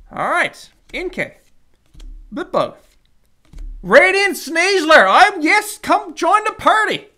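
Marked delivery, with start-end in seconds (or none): 3.8-7.1 high, loud welcome for a good pull; thrilled card collector; excited higher voice. Real, not acted. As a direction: cheer the card into the party. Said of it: "my voice gets higher and higher".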